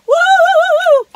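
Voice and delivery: very high, loud woo; joyful whoop. Real, not acted. Child within goes crazy.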